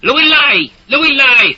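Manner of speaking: high, loud shouting of orders; screaming. A narrator's reading. Soldier screaming at villagers to move back, high loud yell. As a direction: yell it at the crowd, full voice. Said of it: "Stink would scream".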